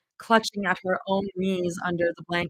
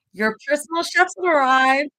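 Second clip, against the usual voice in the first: higher, bright forced-cheerful announcement; 6 semitones higher, 4 dB louder than usual. Acted. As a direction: put on a cheery front. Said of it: "Zoe forced a grin".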